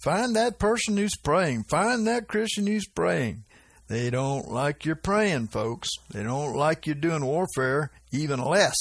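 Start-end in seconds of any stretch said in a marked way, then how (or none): 0.0-3.5 higher, mock demon scream; lightly voiced demons screaming. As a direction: call out the hunt like a demon, pitched up. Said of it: "the demons screaming".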